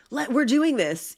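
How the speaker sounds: high, eager burst of excitement; bursting with an idea; excited. Retold or imitated. Wife blurting the plan to her husband, high and eager. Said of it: "when I said my excitement".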